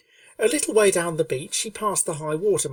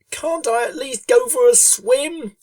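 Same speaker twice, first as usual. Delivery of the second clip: higher, louder pleading pony voice, slow; plaintive Rainbow Dash; 9 semitones higher, 7 dB louder, slower than usual. A narrator's reading. Rainbow Dash pleading with Fluttershy for a swim, high plaintive voice. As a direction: beg like a hot, bored filly. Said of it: "asked Rainbow plaintively".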